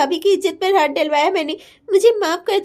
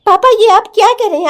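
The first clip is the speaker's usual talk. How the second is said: shocked protest; 3 semitones higher, 7 dB louder than usual. Acted. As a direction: burst out in disbelief. Daughter shocked at father's decision.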